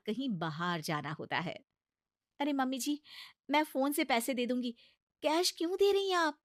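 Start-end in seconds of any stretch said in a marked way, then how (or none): 2.4-6.4 higher, coaxing bahu voice; pleading daughter-in-law. Acted. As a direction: talk sense into a stubborn mother-in-law. Bahu argues about cash. (ऊँची, मनाती हुई बहू की आवाज़)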